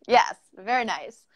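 usual speaking voice

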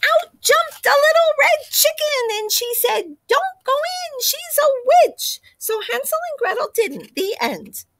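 high, breathless little chicken voice; excited high chicken voice. Acted. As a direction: burst in as an eager little chicken. Chicken bursts into the story.

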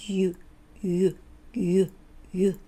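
"ゆ" with a narrowed mouth; short "ゆ", tongue edges against the inner teeth; narrow-lipped YU. Shown as a demonstration. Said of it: "口を割と狭くします". (口を狭くして「ゆ」)

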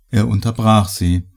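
ordinary delivery

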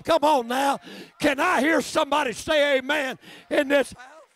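calling for amen. Acted. Preacher calling the church for an amen, loudly. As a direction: rouse the room.

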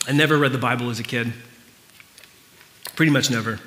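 usual speaking voice

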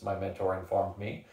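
ordinary delivery

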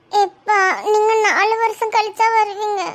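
slow, tearful plea; crying. Acted. Daughter begging her father not to leave, crying. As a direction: voice wet with tears, pleading slowly. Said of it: "அழாதம்மா".

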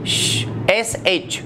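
श breathed slowly, then S H spelled out; slow sh spelling demo. Shown as a demonstration. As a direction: link the sound श to its letters S H. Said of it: "देखिए". (धीरे से श और S H)

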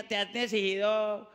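ordinary talk, nothing marked

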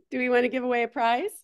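ordinary talk, nothing marked

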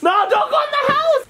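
high shouted plea at dog. Real, not acted. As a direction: yell it to stop the dog.

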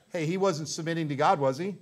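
ordinary delivery

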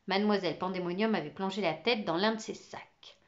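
plain reading voice

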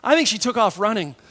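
usual speaking voice

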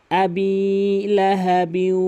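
very slow, drawn-out chanted recitation; Quran recitation. Shown as a demonstration. Teacher reciting the next verse for learners, slow chant. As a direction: stretch each syllable as in tilawat. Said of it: "এরপরে".

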